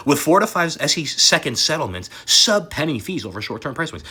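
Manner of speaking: usual speaking voice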